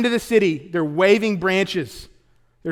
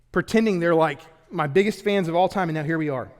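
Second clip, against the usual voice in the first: ordinary delivery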